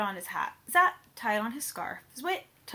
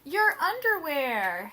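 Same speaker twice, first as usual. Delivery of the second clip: laughing; 4 semitones higher, 5 dB louder, slower than usual. Acted. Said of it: "his mother laughed".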